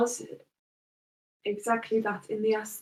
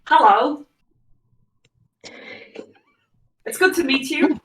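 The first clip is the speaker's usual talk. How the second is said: louder, higher noble lady's hello; Lorella's voice; 6 semitones higher, 8 dB louder than usual. Acted. Lorella greeting Louis she does not remember, louder and higher. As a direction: greet the stranger as the noble lady. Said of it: "Oh, wait, that's my voice".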